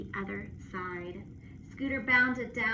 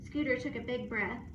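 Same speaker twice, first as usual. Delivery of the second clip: plain reading voice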